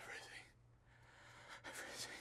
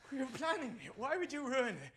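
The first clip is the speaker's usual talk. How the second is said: angry accusation; 3 semitones higher, 6 dB louder than usual. Acted. Husband rages about lost baby.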